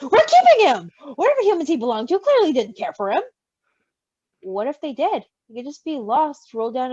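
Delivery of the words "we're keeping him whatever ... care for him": higher, louder indignant outburst; bristling. Acted. Roman bristling at Virgil, refusing to give up the baby. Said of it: "Roman bristled".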